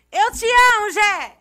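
loud, high declaration shouted across the room; hammed-up shouted love call. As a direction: yell it out like a smitten fan. Calling out love to Gé.

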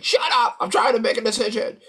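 high, loud, fast irritated snap; retold man snapping at a stranger in line; angry snap. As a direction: bite her head off, impatient and rushed. He snaps at the lady in line.

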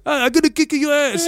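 high-pitched mock Italian accent. Co-host mocking how a threat sounds to people, in a high Italian accent. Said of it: "speaking with an Italian accent to people".

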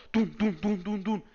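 thumping heart from nerves; heartbeat imitation. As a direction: mimic a racing heartbeat. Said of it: "Era tipo".

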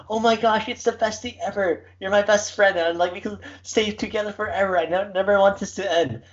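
high, gushing imitation of an adoring dog; high-pitched mock puppy devotion; excited happy dog. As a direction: gush like an overjoyed dog to its owner. Voicing happy dogs, owners home.

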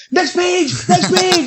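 urgent. Retold or imitated. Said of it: "I'm like".

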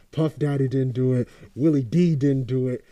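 usual speaking voice

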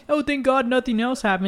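high-pitched mock relief; imitating laughing relief. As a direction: mimic friends making light of it. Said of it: "like, they're laughing".

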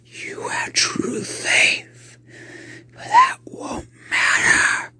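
slow whispered demonic taunt; eerie rasping demon. Acted.

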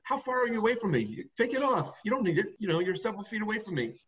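retold order, pitched well up; Trump giving orders. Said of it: "Trump ordered him to take off".

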